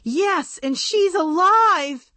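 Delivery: bursting with joyful news; thrilled girl doll confirming; excited. A narrator's reading. Excited news about the aunt.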